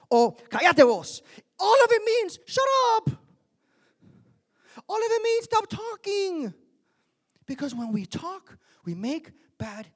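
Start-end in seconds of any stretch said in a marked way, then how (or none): 0.3-1.2 loud, much higher Spanish scold; Nicaraguan scolding. Retold or imitated. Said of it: "And it's like". 1.6-6.5 much higher, loud, slowed shout; shouted preaching. Acted. Peak of preaching.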